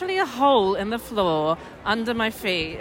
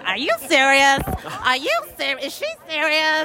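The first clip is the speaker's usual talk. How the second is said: higher, nasal-free Boston accent mock; 6 semitones higher than usual. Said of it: "she was like".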